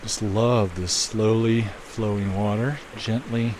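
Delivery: slow, soothing hypnotist drone. Real, not acted. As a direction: lull them with each gently. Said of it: "I sound like a hypnotist".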